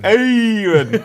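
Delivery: high, loud drawn-out Fonz voice. Pretend. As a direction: ham up a cool Fonz "Aaron". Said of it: "say it like the Fonz".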